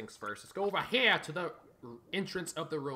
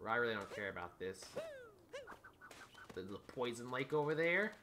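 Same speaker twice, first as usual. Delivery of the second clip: ordinary delivery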